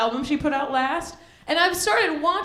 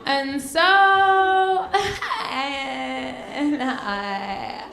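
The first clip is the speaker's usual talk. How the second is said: very slow drawl melting into mere sounds; wordless Hollywood-girl drone; much slower than usual. Acted. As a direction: stretch keywords until they barely sound like words. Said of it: "it doesn't even sound like words anymore".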